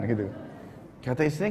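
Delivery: ordinary delivery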